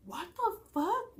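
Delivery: stunned disbelief. Retold or imitated. Hearing his name from mom.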